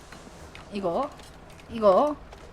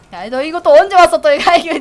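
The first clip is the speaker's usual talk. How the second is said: loud, higher startled yell; alarmed shout; 6 semitones higher, 14 dB louder, faster than usual. Real, not acted. Spotting an enemy again, loud startled shout.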